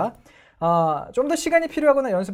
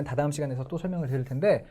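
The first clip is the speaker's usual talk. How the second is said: ordinary talk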